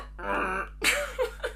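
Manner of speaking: high squeaky mock rabbit sound; high laughing rabbit squeak; rabbit noise imitation. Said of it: "on a rock going".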